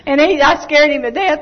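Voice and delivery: ordinary talk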